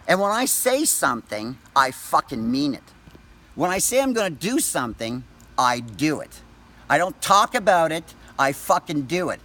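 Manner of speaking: ordinary talk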